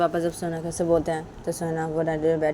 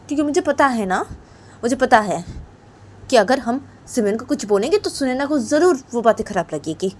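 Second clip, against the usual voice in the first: mildly reproachful mother; 9 semitones higher, 10 dB louder than usual. Acted. Mother reasons crossly with father.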